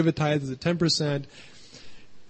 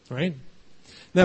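ordinary delivery

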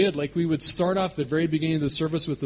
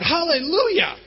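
high, loud praise shout; very high, full-voiced cry of praise; screaming out; 13 semitones higher, 6 dB louder than usual. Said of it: "she screams out".

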